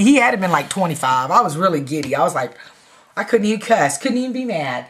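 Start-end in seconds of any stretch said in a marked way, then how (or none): none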